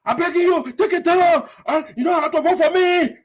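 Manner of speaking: high, loud mock pleading; imitating the man. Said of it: "he sounds just like T I J".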